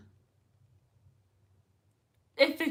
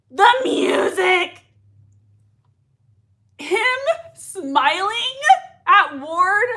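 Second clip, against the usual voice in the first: loud, high fangirl exclamations; excited outburst; 7 semitones higher, 7 dB louder, slower than usual. Real, not acted. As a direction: gush loudly, voice leaping up with excitement. Reacting excitedly to a scene.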